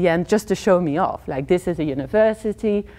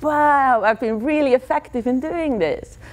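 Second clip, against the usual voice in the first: high-pitched self-impressed wonder; naive amazement; 6 semitones higher than usual. Retold or imitated. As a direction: marvel at your own success. Amazed at men running away.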